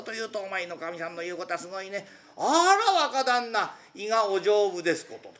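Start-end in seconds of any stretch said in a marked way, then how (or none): 2.3-5.3 high, louder mock-polite wife's voice; sarcastic landlady. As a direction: needle him with a sweet, loud sneer. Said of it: "こういうこと言うんだよ". (皮肉な奥さんの高い声)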